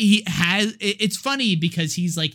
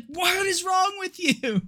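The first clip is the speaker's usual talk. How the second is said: high-pitched put-on protest; mock outrage; 12 semitones higher than usual. Girl pelted with salt protests, high mock outrage. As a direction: imitate an appalled victim in a high voice.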